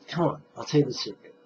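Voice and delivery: ordinary delivery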